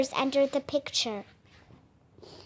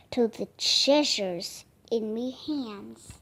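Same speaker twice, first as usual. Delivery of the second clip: menacing whisper; 3 semitones lower, 5 dB quieter, slower than usual. A narrator's reading. Bones menaces the children.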